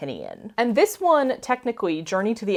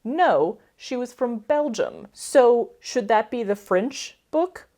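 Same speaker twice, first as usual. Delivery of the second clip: normal talking voice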